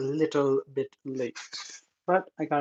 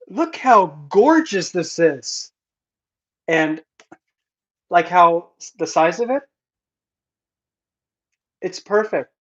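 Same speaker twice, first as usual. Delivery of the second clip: slow, louder, higher overwhelmed gushing; real overjoyed gushing over her own book; emotional gushing; 3 semitones higher, 10 dB louder, slower than usual. Real, not acted. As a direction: savour each word, close to tears with joy.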